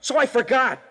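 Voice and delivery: put-on cornered kid lashing out; defensive outburst. Cornered suspect snaps back.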